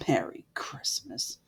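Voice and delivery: whispered with contempt; scornful whisper. A narrator's reading. Scrooge mocking the greeting in a whisper.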